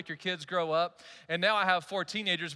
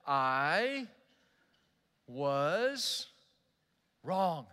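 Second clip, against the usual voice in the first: slow and deliberate; 2 semitones lower, much slower than usual. Shown as a demonstration. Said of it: "give yourself some time and just say".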